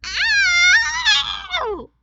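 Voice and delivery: very high, loud squeal of joy; cheering bird. A narrator's reading. Gertrude seeing her new feathers, squealing loudly. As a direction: squeal with delight. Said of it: "She cheered".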